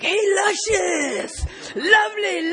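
high, drawn-out heckler shout; imitating inmates yelling catcalls; imitated catcall yell. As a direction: holler it across a cell block. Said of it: "they started yelling stuff like".

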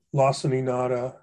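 ordinary talk

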